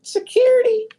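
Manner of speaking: mock panicked shout for security. Streamer mocking the analyst who called security, high squeal. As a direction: squeal for help like a scared nerd. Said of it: "Said he gonna call the police on me".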